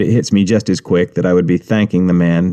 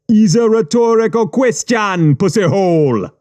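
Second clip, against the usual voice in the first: Jamaican gangster; 10 semitones higher than usual. Acted. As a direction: tease him with what you know. Gangster mocking.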